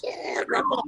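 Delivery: put-on breathless whisper of a thrilled kid; excited whisper. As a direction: hush it out, awed and eager. Excited kid telling grandpa.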